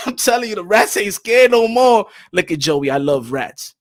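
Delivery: ordinary talk, nothing marked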